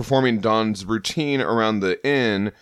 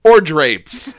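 booming outraged herald; 4 semitones higher, 6 dB louder than usual. Acted. Surfer ranting, booming.

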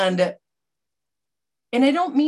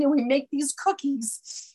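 normal talking voice

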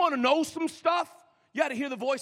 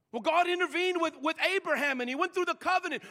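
normal talking voice

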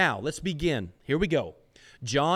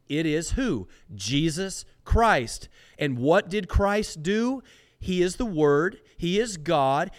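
fervent preaching; 4 semitones higher than usual. Real, not acted. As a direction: drive home who Christ is. Preaching at full force.

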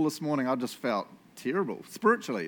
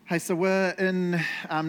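ordinary talk

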